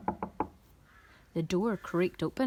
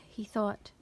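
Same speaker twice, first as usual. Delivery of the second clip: ordinary delivery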